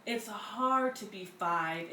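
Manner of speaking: plain reading voice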